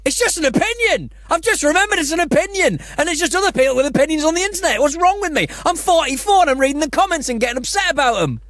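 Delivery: high, incredulous self-mocking rant; mock her own outburst at reading comments; exasperated self. Retelling her podcast cohost a high mocking rant. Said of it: "So I'm like".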